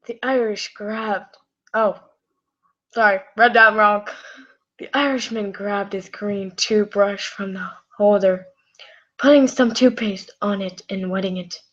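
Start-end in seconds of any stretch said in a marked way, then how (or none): none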